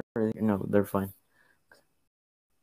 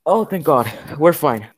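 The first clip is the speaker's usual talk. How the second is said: relieved; 4 semitones higher, 8 dB louder than usual. Real, not acted. Relief after surviving the night.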